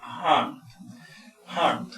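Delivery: harmed, M then a final D sound. Shown as a demonstration. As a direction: say harmed, ending on a D. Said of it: "la M y la D".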